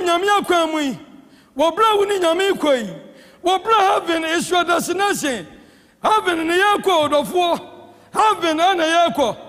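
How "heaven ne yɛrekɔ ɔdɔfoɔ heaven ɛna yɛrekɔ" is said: ordinary talk, nothing marked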